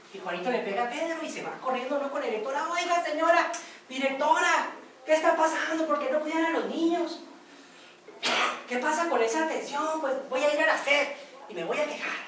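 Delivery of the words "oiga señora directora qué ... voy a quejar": madre indignada. Acted. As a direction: exige explicaciones a la directora. Madre reclama a la directora. (madre reclamando indignada)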